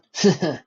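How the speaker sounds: loud hearty guffaw. A narrator's reading. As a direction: burst out in a booming laugh. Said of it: "Vladimir guffawed".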